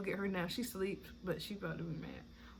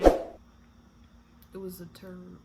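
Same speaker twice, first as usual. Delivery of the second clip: slow imitating husband; 3 semitones lower, much slower than usual. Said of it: "He said when I was pushing".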